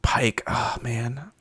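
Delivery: hushed aside; breathy whisper under the breath. Real, not acted. Whispered aside about Pike.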